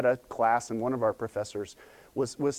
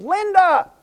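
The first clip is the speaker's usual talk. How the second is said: high, very loud call to someone far behind; imitated yell; 12 dB louder than usual. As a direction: yell the name out, acting the student. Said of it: "one of the students yelled".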